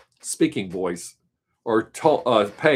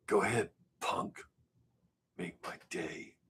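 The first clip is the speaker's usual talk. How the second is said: low raspy near-whisper, much softer; Clint Eastwood whisper; 5 semitones lower, 14 dB quieter than usual. Retold or imitated. Quoting Clint Eastwood to show his whispery voice. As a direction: menace them in a hushed Dirty Harry rasp. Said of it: "Clint Eastwood ... too whispery".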